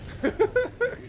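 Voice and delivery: high-pitched cackle; gleeful high chuckle; gloating laugh. Acted. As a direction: cackle over a beaten enemy. Gloating while firing.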